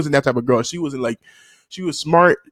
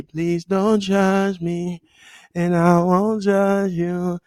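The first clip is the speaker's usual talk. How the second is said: drawn-out, higher pleading; slow mock plea; 5 semitones higher, much slower than usual. Real, not acted. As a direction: beg the viewers slowly not to judge. Pleading with the audience.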